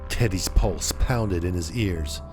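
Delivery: ordinary delivery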